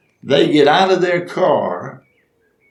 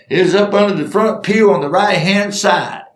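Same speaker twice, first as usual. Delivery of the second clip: higher-pitched, raised answer; pitched-up holler back; acted calling back; 7 semitones higher, 3 dB louder than usual. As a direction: call the location across the room. Crew member calls back.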